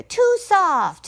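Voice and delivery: put-on high, fussy girl voice; girl complaining. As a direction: reject it like a picky child. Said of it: "she said".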